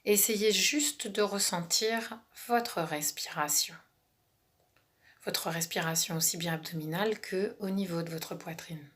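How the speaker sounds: calm relaxation guide, half whispered; guided meditation. Acted.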